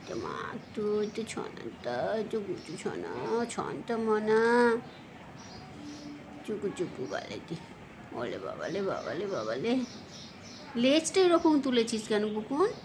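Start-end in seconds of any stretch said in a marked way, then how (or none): 0.1-9.7 soft, cooing pet talk, nearly breathed at the end. Real, not acted. (আদুরে আধো আধো গলায়)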